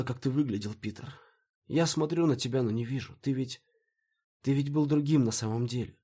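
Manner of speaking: high-pitched, distressed outpouring; tearful. A narrator's reading. Maya confesses to Peter in her dream, tearful. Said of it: "Ты что, плачешь?".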